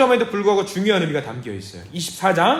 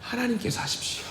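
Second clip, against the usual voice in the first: soft, hushed plea to God; quiet prayer; 4 semitones higher, 9 dB quieter than usual. Shown as a demonstration. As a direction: pray it quietly, handing it over.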